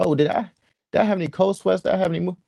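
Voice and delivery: high, wondering inner voice; wondering disbelief. Retold or imitated. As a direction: replay the moment you realize something's missing. Retold inner thoughts in disbelief.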